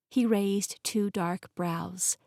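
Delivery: ordinary delivery, nothing marked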